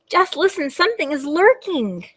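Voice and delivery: high-pitched storybook warning; play-acted suspense; wary little dragon. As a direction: warn them that something is out there. Dragon warns of lurking monster.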